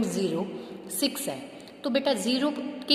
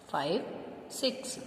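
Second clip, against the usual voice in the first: Five and six as two separate words with a pause; digits said apart; 5 semitones lower, 10 dB quieter than usual. Shown as a demonstration. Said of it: "इसको fifty six मत बोलना". (Five और six अलग-अलग रुककर)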